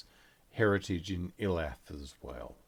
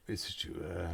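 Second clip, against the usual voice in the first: ordinary talk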